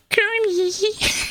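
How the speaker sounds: very high, drawn-out swoony call; Miss Piggy. Retold or imitated. Streamer's Miss Piggy calling Kermit, very high. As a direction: sigh the name like a lovestruck diva. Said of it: "Miss Piggy impression".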